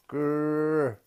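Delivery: high, loud mock bear growl; playful growl. A narrator's reading. Little girl growls at her daddy like a bear. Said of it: "growled Ursula".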